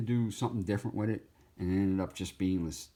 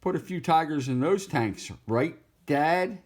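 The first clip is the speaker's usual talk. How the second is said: high, loud cartoon cub voice; young tiger voice; 7 semitones higher, 5 dB louder than usual. Young tiger calling out to his dad. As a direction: pipe it up like an eager tiger cub. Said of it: "this guy who's a Tiger saying".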